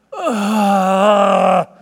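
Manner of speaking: long, loud groan of pained exasperation; exasperated groan. Acted. Preacher showing how Jesus sighed at the doubters, exasperated groan. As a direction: groan as if your insides hurt. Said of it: "This was just like a exasperation".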